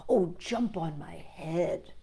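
soft, slow coaxing; sly fox. Acted. The fox coaxing the gingerbread man, soft and slow. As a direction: lure him closer slowly. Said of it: "cried the fox".

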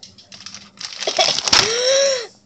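sharp cough from the sour taste; coughing fit mid-bite; coughing at sour chips. Real, not acted. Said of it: "it's so sour".

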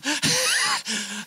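a high-pitched burst of laughter. Real, not acted. Joking about New Zealanders, high laugh. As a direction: laugh at your own joke. Laugh after Kiwi joke.

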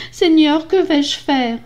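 high-pitched plea, mildly distressed; lightly acted tearful lament; tearful despair. A narrator's reading. Young wife to herself, mildly tearful despair. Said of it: "Elle se mit à pleurer".

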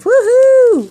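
a big, high, loud whoop of joy; excited cheer. Real, not acted. Crafter cheering her Colombian friend's card, excited whoop. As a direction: let out a real whoop for the gift.

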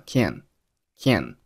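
"can't" ending on the n; unreleased t in "can't". Shown as a demonstration. Teacher demonstrating "can't" without its final t. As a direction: stress "can't" but swallow its t. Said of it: "结尾的t并没有爆出来".

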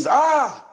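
sharp, high retold scream. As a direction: imitate his cry, short and high. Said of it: "I could hear him scream".

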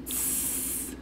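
voiceless hissing tss; snake hiss. Shown as a demonstration. Teacher modelling the snake hiss for the children. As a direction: hiss through the teeth like a snake. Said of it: "Can you make a tss hiss sound like a snake?".

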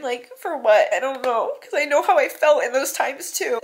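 usual speaking voice

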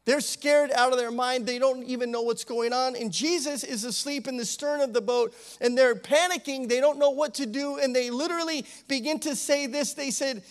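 ordinary talk

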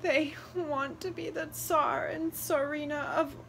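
high, breaking and tearful, fading to a whisper; tearful daughter's answer; sobbing. A narrator's reading. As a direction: answer through sobs. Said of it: "Luba answered as she sobbed".

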